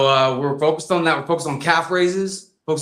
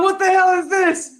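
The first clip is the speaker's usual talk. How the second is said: high, incredulous cry; shocked disbelief; 13 semitones higher than usual. Retold or imitated. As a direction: react like you can't believe your eyes. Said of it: "I'm like".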